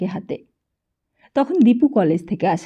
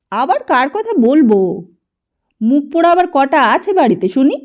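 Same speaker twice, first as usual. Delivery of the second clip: louder, higher cranky retort; irritated old man; 4 semitones higher, 7 dB louder than usual. A narrator's reading. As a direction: snap back like a testy old man. Grandfather scolding mother.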